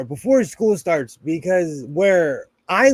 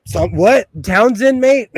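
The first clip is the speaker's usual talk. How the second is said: loud, high British mate voice; British accent; 4 semitones higher, 7 dB louder than usual. Shown as a demonstration. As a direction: throw on a cheeky London accent. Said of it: "I can bear".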